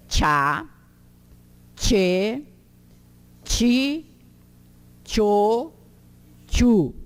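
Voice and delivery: "tchá, tchê, ti, tchô, tchu" loud, separated, all with tch, "ti" as chee; each of "tchá, tchê, ti, tchô, tchu" held out with a ch onset; TCH syllable drill. Shown as a demonstration. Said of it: "ele tem esse som assim".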